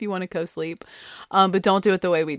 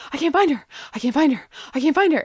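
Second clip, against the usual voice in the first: imitating a panicked husband, higher pitched; frantic; 7 semitones higher, faster than usual. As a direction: repeat it in mock panic, pitched up. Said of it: "and he's frantic".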